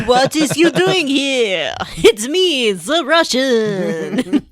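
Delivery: put-on over-the-top video game Russian thug accent; bad Russian accent. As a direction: ham up a cheesy Russian accent, drawing every word out. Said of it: "The accents aren't very good in this game".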